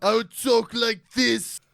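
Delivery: slow, loud northern brogue; booming northerner voice; northern accent. Pretend. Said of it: "Is that how you would talk?".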